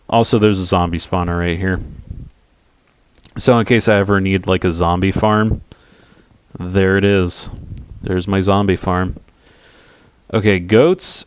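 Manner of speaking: ordinary delivery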